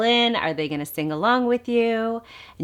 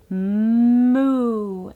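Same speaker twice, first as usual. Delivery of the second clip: long, louder mooing cow; 4 semitones higher, 5 dB louder than usual. Shown as a demonstration. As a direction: moo like a farm cow. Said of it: "the cow says".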